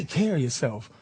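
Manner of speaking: put-on low, soft older-man farewell; lightly imitated, hushed goodbye; William Shatner. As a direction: drop low and soft to send him off. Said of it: "it was just like".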